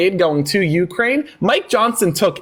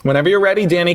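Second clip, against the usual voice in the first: ordinary talk, nothing marked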